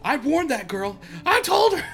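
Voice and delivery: mock dub line. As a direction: ham up a bad dub actor, squeaky and loud. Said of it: "when she's in the fire".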